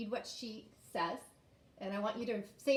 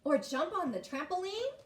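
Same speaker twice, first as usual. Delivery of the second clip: eager, voice jumps up and louder; excited interruption; 8 semitones higher, 5 dB louder than usual. A narrator's reading. Girl interrupts her mom with a happy idea, excited. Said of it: "Nolly interrupts excitedly".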